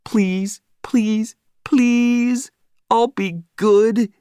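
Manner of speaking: high pleading puppy voice, rising each please; pleading dog voice. A narrator's reading. Marley the dog silently begging his owner, high pleading voice. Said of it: "I could read his thoughts".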